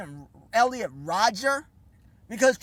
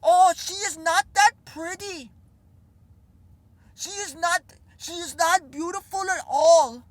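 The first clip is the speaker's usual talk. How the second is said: higher, mocking imitation of the pundit; mocking Jordan Peterson; 5 semitones higher than usual. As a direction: sneer at him by imitating. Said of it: "this is what he said".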